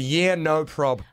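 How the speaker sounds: drawn-out, deadpan dad reply; mock unbothered, slowly; slow deadpan quote. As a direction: drag it out to show how little he wrote. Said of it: "And he replied".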